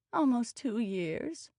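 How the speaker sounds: high, drawn-out whine; slow, rising whiny protest; voice scaling up. A narrator's reading. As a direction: let the complaint climb in pitch. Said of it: "her voice scaling up".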